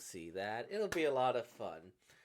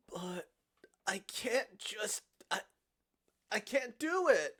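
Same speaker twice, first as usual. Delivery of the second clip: halting, then breaking out high and loud; falter, then cry out helplessly; agonized, stumbling protest; distressed refusal; 6 semitones higher, 5 dB louder than usual. Acted. Character balks at killing her.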